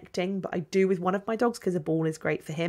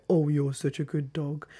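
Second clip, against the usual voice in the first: flat dull praise; 4 semitones lower than usual. Shown as a demonstration. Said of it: "you don't go".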